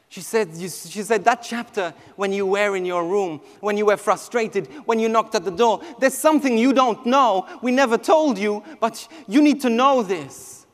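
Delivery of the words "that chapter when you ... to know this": ordinary delivery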